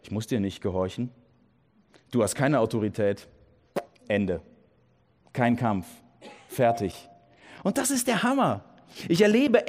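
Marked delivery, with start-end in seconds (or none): 0.0-7.1 firm dismissal. Retold or imitated. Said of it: "ich sage".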